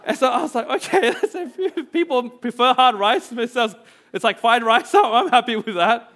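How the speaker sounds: high, loud laughter over the words; can't stop laughing while telling it. Real, not acted. Still laughing at the punchline.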